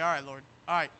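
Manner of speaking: low, soft giving-in; meek compliance. Acted. Preacher as a believer answering the Lord, low meek agreement. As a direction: submit quietly, voice dropped.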